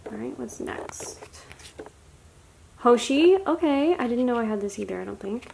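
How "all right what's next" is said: a soft whispered aside. Real, not acted. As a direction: whisper it to yourself.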